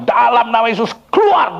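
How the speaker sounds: high shouted order to a demon; exorcism command. Retold or imitated. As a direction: build to a cry on the last word. (perintah mengusir setan, nada meninggi)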